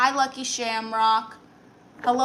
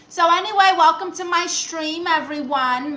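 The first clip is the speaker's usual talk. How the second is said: big, much higher and louder stream greeting; excited welcome; 6 semitones higher, 6 dB louder than usual. Real, not acted. Welcomes the viewers to the stream, loud and excited. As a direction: throw open the doors to the stream.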